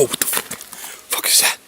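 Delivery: frightened whisper. Real, not acted. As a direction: hush it, scared. Sees something in dark.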